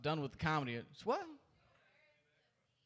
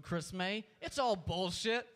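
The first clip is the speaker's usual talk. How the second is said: ordinary talk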